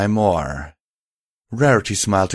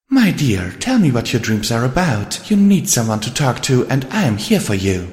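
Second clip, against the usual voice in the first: higher, earnest character voice; reassuring lady, pitched up; caring friend Rarity; 5 semitones higher, 4 dB louder than usual. Acted. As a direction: coax a troubled friend to open up. Rarity comforts Twilight.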